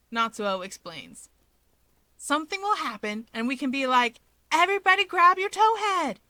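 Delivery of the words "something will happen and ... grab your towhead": bright, rising eager voice climbing to a loud call; excited. Acted. Natsuo to the group, pitching his plan excitedly.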